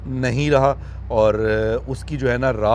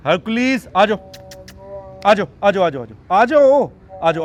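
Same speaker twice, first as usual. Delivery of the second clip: louder, higher voice coaxing the cub out; louder high coaxing call to the cub; 6 semitones higher, 7 dB louder than usual. Real, not acted. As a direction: call him over warmly. Calling cub.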